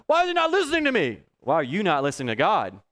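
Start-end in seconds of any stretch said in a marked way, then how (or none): 0.1-1.2 high whiny mock complaint. Preacher mimicking a frustrated churchgoer: high whiny mock. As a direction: imitate the frustrated listener, pitched up. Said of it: "You're not li".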